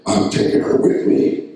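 slow, voiceless rasp of a demon; menacing imitated demon, all breath and no tone; evil demon voice. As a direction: rasp it slowly like the demon speaking through her. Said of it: "The eagle's voice".

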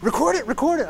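excited urging. Retold or imitated. Timothy urging the pastor to film the sticker, excited and high. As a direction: push him to film it now. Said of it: "He goes".

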